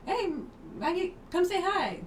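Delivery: high, loud sing-song mom call; imitating a mom calling her daughter to the phone, high and loud. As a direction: call your kid over, high and bright.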